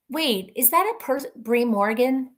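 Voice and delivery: normal talking voice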